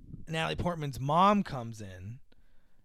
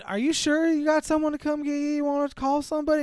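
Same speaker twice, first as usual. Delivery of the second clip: much higher, gentle worried questions; concerned nurse; 11 semitones higher, 3 dB louder than usual. Retold or imitated. Nurse asking the abandoned girl about a ride, high worried. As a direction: check on her kindly. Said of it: "The nurse going".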